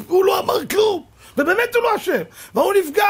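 high-pitched incredulous shouting; loud, worked-up indignation; exasperated protest. Acted. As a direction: cry out in disbelief. Protesting a needless offence.

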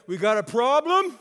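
angry driver shouting across lanes; retold yell. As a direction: bark it at the other driver. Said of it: "he yells at his window".

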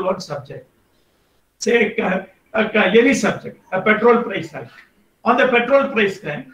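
normal talking voice

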